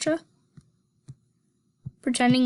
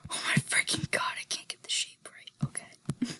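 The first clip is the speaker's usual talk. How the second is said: whispering; 9 dB quieter than usual. Real, not acted. Frustrated muttered aside while drawing.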